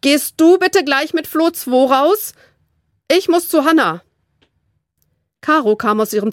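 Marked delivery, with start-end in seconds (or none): 0.0-4.0 higher, calling through the stairwell; lightly raised calling voice. A narrator's reading. Flo calls to her sister Caro through the stairwell, lightly raised. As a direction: call up the stairs to the little sister.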